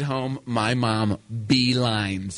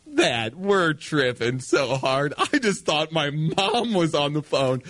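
dragged-out dazed words; slow and spaced out; slow stoned voice; 3 semitones higher, slower than usual. Retold or imitated. Stoned confused plea.

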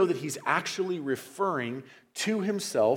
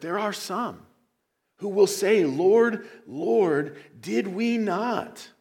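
louder, higher plea, slow at the end; preaching at full force; 7 semitones higher, 5 dB louder, slower than usual. Acted. Preacher voicing those refused entry, loud then slowing.

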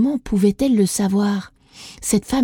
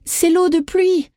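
sudden cry; 9 semitones higher than usual. A narrator's reading. Isadora crying the answer to the priestess, triumphant. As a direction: shout out the answer in joy. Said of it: "cria soudain Isadora".

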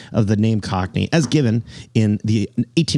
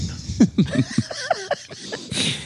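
bursts into a high airy laugh; laughing at his own quote; high breathy laugh; 5 dB quieter than usual. Real, not acted.

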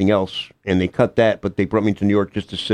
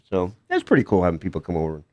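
ordinary talk, nothing marked

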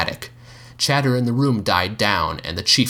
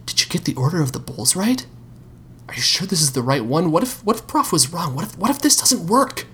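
anxious inner doubt; 9 semitones higher than usual. Acted.

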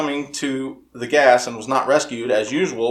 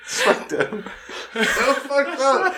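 laughing; 13 semitones higher, slower than usual. Real, not acted. Laughing at a shocking story.

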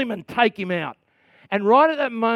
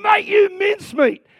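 mock dragon taunt; 11 semitones higher, 4 dB louder than usual. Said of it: "I'm going".

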